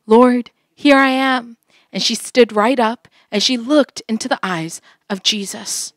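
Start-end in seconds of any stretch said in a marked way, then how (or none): 0.1-1.6 slow, louder answer; answering the Lord. A narrator's reading. Woman answering Jesus, slow and louder. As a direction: answer slowly and firmly. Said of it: "Elder Nam said".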